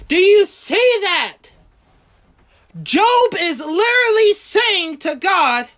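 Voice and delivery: high-pitched, driving sermon delivery; impassioned preaching. Real, not acted. Preacher marvels at Job's love for God, impassioned preaching.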